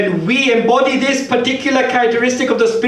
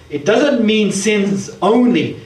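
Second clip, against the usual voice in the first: ordinary talk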